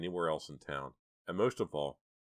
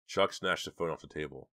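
ordinary delivery, nothing marked

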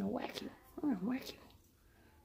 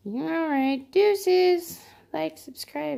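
sign-off; 6 semitones higher, 8 dB louder, much slower than usual. Real, not acted. Vlog sign-off.

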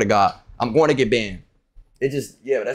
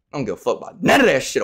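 ordinary talk, nothing marked

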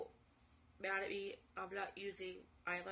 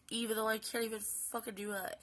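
usual speaking voice

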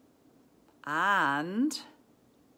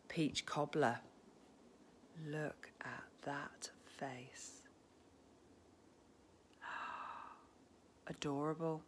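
hushed, slow whispered adoration; breathless awe; awed whisper; 3 semitones lower, 11 dB quieter than usual. Real, not acted. Gasping over new bear.

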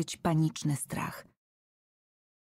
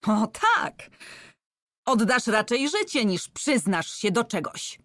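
raised, high angry retort; angry, cutting in; 5 semitones higher, 4 dB louder than usual. A narrator's reading. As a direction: cut him off in anger. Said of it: "przerwała mu równie rozzłoszczona". (ostro i ze złością, przerywając)

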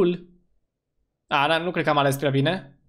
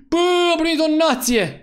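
high, loud gasp into a shout; gasp, then shout it high with excitement; thrilled outburst; 11 semitones higher, 4 dB louder than usual. Real, not acted. Streamer getting a donation, thrilled shout.

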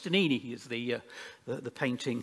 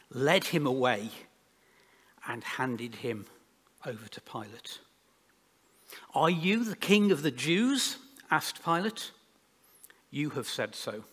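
measured, dramatic Bible reading with spaced phrases; scripture reading; 3 dB louder than usual. Acted. Preacher reads the Passion to the congregation, solemn public reading. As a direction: read the Gospel aloud, pausing between phrases.

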